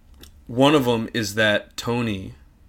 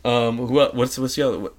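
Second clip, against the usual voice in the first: usual speaking voice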